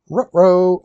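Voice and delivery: dog-like Scooby-Doo uh-oh, with rolled r's on ruh-roh. Pretend. Streamer fumbles a piece, goes Scooby-Doo.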